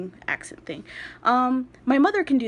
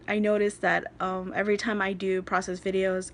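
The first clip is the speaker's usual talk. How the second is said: normal talking voice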